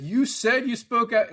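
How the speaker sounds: high, slow imitated insistence; insistent accusation. Cop pushing a witness to admit it, insistent. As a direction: press the point as if pinning him down. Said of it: "he's trying to get people to say what he wants".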